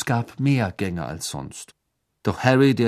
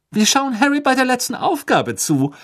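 bright, much higher cheerful voice; beaming; 6 dB louder than usual. A narrator's reading. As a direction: beam as you tell him. (strahlend, hoch und fröhlich)

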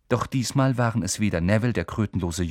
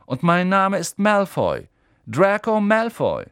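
high, louder, slow and self-important; proud self-introduction; 9 semitones higher, 6 dB louder than usual. A narrator's reading. Malfoy announces his name to Harry, puffed up. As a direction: savour your own name.

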